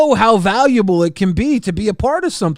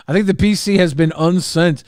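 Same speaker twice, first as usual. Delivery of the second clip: ordinary delivery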